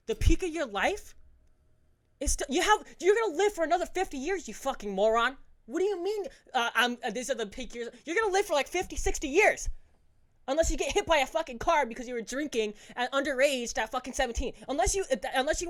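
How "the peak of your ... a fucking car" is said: loud, high, exasperated shouting; angry high rant. Real, not acted. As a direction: yell at them in disbelief. Ranting at drinking teenagers.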